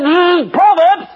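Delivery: high, loud sneering "Proverbs"; mocking the preacher's answer; scornful mockery. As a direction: spit the word out in contempt. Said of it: "He went berserk".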